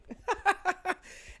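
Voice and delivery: high wheezing laugh. Real, not acted. Laughs after the story.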